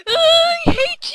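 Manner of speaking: high, loud, slow whiny cry; whiny girl voice. Retold or imitated. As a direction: whine like an upset little girl. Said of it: "probably be like".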